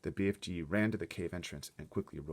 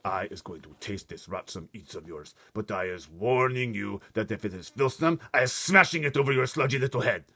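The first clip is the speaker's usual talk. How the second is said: starts level, grows louder and higher into a threat; giant threat building; 3 semitones higher, 8 dB louder than usual. Acted. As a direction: warn him, then swell to a menacing roar. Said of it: "the blood bottler says".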